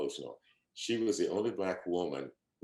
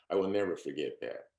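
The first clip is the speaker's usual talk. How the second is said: ordinary talk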